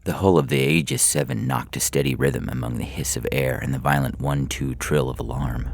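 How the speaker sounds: ordinary delivery, nothing marked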